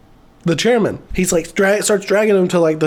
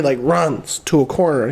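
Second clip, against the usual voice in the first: ordinary talk, nothing marked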